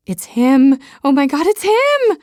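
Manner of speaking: high, loud excited squeal on him. Acted. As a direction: shriek it out like a thrilled friend. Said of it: "she squeals".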